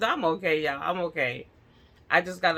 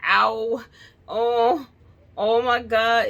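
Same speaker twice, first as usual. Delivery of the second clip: mock pained wail; half-acted hurt moaning; retold crying; 4 semitones higher, 5 dB louder, much slower than usual. Telling her husband what happened, a mock pained wail. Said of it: "I'm like".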